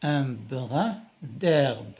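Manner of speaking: un brin d'herbe drawn out slowly; slow syllable demo. Shown as a demonstration. Teacher stretching un brin d'herbe slowly for pupils. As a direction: spell and stretch brin for the class. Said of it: "B R".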